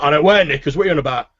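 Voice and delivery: high, loud bickering; irritated retort. Acted. Orc barkeep bickering with the cook, loudly indignant.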